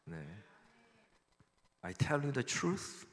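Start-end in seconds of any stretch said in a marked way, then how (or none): 1.8-3.1 very slow English, each word drawn out; reading the verse in English, very slowly. Shown as a demonstration. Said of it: "이렇게 답니다".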